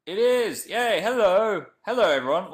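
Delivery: loud, high delighted greeting; thrilled the stream connected, calling hello to viewers; excited. Real, not acted. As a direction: burst in thrilled the stream works. Excited start of a livestream.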